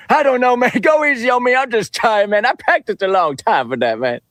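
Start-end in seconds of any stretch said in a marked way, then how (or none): none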